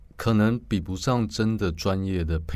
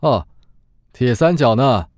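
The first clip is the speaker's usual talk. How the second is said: a touch higher, loud agreeing quip; Xiaoba chiming in; 6 semitones higher, 10 dB louder than usual. Acted. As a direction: chime in with a wink. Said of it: "小八附和说". (小八附和的俏皮话)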